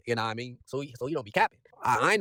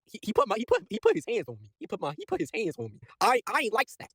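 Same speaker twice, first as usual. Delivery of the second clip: higher-pitched, stammering complaint; unsafe goon; 8 semitones higher than usual. Acted. Recapper as a goon complaining to Osborn, higher rattled stammer. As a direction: stutter it out like a rattled tattletale. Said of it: "one of the other goons felt unsafe".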